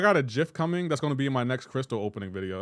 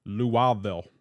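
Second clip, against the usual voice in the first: hammed-up Southern way of saying the city; imitated Southern accent. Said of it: "what accent I'm imitating".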